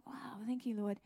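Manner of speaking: soft, amazed whispered prayer; awed whisper. Real, not acted. [name] quietly thanking the Lord, awed whisper.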